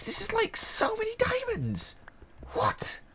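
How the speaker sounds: breathless high whisper of wonder; awed whisper. Real, not acted. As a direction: hush it like a secret treasure. Awed by so many diamonds.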